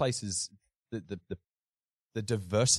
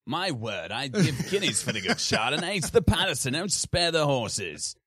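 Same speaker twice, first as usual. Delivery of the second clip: hammed-up upper-class English toff, higher and louder; posh Eton dropout; 8 semitones higher, 7 dB louder than usual. Builder sending up an Eton dropout on the site, hammed-up posh voice. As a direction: lay on a mock Eton drawl, slowing right down at the end. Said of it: "a dropout from Eton and he'd be like".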